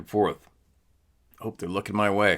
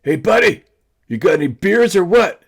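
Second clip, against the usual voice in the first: much higher, loud holler across the river; calling out in a disguised voice; 11 semitones higher, 9 dB louder than usual. Retold or imitated. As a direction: yell it in a put-on voice so he won't know you. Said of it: "and I'm like".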